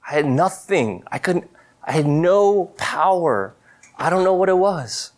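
a pastor reliving his lowest night, voice breaking. Real, not acted. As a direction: let the voice crack with real emotion. Recalling helpless breakdown moment.

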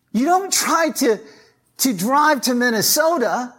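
high, loud, urgent preaching; preaching at full force. Acted. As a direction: drive the point home to the congregation.

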